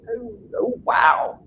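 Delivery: high breathy oh, then whispered wow; surprised gasp. Retold or imitated. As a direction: gasp at what you read. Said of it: "I was like".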